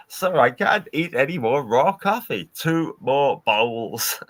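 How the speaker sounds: a hint of a put-on sickly voice, slow end; Red Dwarf quote. Quoting the Red Dwarf coffee scene in a lightly put-on voice. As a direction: lightly act the weary crewman. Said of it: "it's like the Red Dwarf episode".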